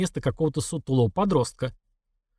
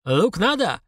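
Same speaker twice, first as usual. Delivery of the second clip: put-on hoarse Caucasian-accented vendor, pitched up; 6 semitones higher, slower than usual. A narrator's reading. Vendor approaching an old woman with onions, husky Caucasian accent. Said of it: "хрипловатый кавказский баритон".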